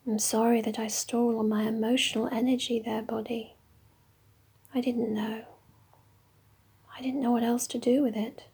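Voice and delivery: usual speaking voice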